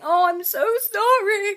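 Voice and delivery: high, slow, breaking into real tears; crying out an apology, voice cracking; tearful apology. Real, not acted. Mum apologising to her kids, crying. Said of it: "And then I just think".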